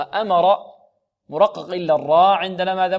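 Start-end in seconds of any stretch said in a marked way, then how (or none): none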